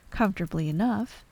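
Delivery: ordinary delivery, nothing marked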